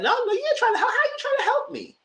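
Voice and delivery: very high, laughing mock of Sutton; mocking squeaky voice. Mimicking Sutton cutting Dorit off, squeaky and laughing. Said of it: "It's like".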